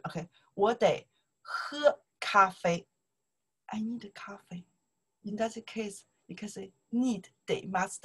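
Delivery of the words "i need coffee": whisper. Shown as a demonstration. Teacher translating example sentence.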